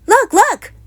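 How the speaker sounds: high, loud cubs crying out; excited shout. A narrator's reading. Cubs shouting to their parents about toys. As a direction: yell it, thrilled at the toys. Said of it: "shouted the cubs once again".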